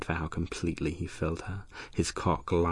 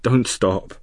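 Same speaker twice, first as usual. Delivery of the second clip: louder and higher, desperate moan; moaned plea; 5 semitones higher, 10 dB louder than usual. A narrator's reading. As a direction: cry out for him not to stop. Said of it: "she moaned".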